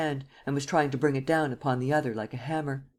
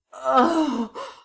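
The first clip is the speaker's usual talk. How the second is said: high, sharp yelp of pain; Gérard cries out in pain; cry of pain; 11 semitones higher, 4 dB louder than usual. Acted. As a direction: cry out as the blade cuts. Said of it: "with a moan of pain".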